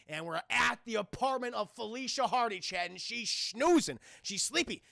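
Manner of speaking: ordinary talk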